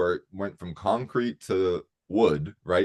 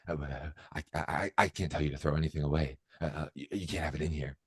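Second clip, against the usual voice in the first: mumbled, much softer and quicker, hesitant stammer; timid guard; 5 semitones lower, 9 dB quieter, faster than usual. Retold or imitated. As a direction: shrink back like a flustered guard. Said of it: "And she goes".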